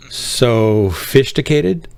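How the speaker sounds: sophisticated stretched slowly, rising; mock-savoring "sophisticated" slowly with a rise; drawn-out word. Shown as a demonstration. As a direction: drag out "sophisticated" like a tease. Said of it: "Are you too sophisticated?".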